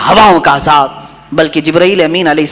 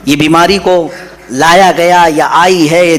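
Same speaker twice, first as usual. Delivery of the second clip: ordinary talk, nothing marked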